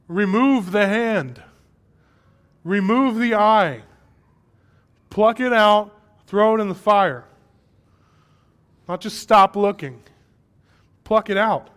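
slow, loud, hammered imperatives; emphatic preaching command. Retold or imitated. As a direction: preach it as a stern command, pausing after each blow. Preacher voicing Jesus' drastic command.

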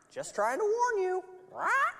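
high squeaky parrot. Acted. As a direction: chirp it like a bird. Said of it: "Parrot said".

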